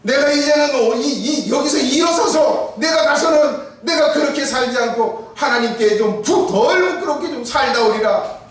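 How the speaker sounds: high, loud resolute vow; fervent vow. Retold or imitated. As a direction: swear to live cleaner from now on. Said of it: "다짐을 한다는 거예요". (결연하게 외치는 다짐)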